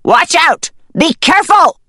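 high, loud urgent shout; hollered warning. Acted. Hidden voice to trapped hen, hollered warning. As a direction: holler a warning across a distance. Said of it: "the voice hollered".

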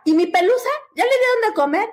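imitando a la mamá ansiosa, más aguda; mamá preocupada. Retold or imitated. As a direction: pregunta urgente por su perrita. Mamá pregunta por teléfono. (la mamá pregunta por su perra, más aguda)

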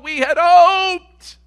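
high, loud and drawn out, slow; loud, slow lament. Acted. Preacher crying out to the congregation, loud and slow. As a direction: cry out the loss of hope to the church.

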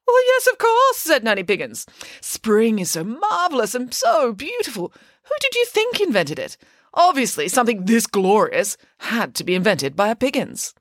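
high, grand boast; boastful pig nanny. Acted. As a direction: crow about it proudly.

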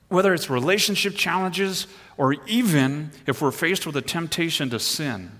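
ordinary talk

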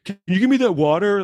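high imitated beggar voice; begging man. Homeless dude eyeing his big water, high mock begging voice. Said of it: "he was like".

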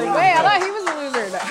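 loud high disbelief; surprised protest. Real, not acted. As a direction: blurt it out in shock.

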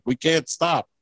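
normal talking voice

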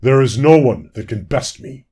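loud, boastful ruler's voice; proud proclamation. A narrator's reading. Fawn lord tells the crowd none can best him, proud and booming. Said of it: "Fawn Lord proclaimed proudly".

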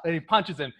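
ordinary talk

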